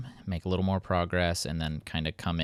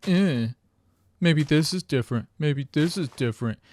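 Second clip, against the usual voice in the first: higher, louder snide mocking; mock sarcastic; 7 semitones higher, 5 dB louder than usual. Me mocking Dolan while breaking grass, louder snide mimicry. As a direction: throw his words back at him, hammed-up.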